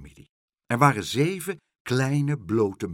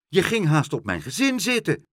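higher and louder, scolding; reproachful; 8 semitones higher, 5 dB louder than usual. A narrator's reading. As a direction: tell him off for nearly sitting on them. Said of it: "riep de muis verwijtend".

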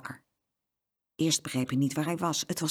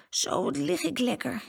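sleepy little voice; 8 semitones higher than usual. A narrator's reading. Boy settling down on the back seat, sleepy. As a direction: murmur it drowsily in a small high voice. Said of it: "met een slaperig stemmetje".

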